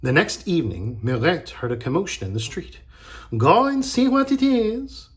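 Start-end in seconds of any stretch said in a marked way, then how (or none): 3.4-5.1 high, loud, singsong prompting; urging mother. A narrator's reading. Mother sends Mirette out to look, brightly urging. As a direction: send the child off, high and loud. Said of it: "her mother said".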